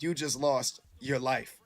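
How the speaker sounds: slow, lightly voiced narrator from the record; a hint of the eerie intro voice; imitating the album intro voice. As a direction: draw out the line like the song's intro. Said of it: "when the lady was like".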